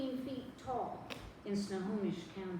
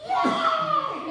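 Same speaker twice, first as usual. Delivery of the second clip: high, loud celebratory yell; screaming cheer; 10 dB louder than usual. Retold or imitated. Crowd cheering the election night result, high screaming yell. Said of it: "And we're screaming and jumping and leaping".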